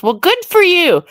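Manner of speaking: high, singsong sarcastic congratulations. Real, not acted. Answering a boast about looking good, sarcastic. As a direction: toss it back with exaggerated high pitch. Retort to photogenic commenter's boast.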